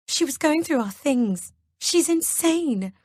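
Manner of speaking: high, slow, shaken; distressed. A narrator's reading. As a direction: sound shaken and accusing. Kelly accusing, distressed.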